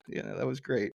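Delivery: ordinary talk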